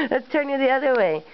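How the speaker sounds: normal talking voice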